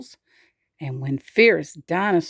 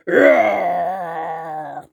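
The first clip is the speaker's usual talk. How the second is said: long growly roar, fading out; drawn-out pretend dinosaur roar; 2 semitones lower than usual. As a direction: let out a little dinosaur's roar. Demonstrates the dinosaur's loudest roar.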